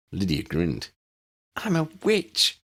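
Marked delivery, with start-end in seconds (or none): 1.6-2.6 high, slow, savoring delight. Acted. Lydia proudly telling the adults, high slow delight. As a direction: relish every word like a treasure.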